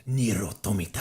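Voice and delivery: Nier Automata in a Spanish accent, rolled r and open vowels; Spanish-sounding Nier Automata, said like nir otomita. Shown as a demonstration. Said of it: "I like to call it in a nice Spanish accent".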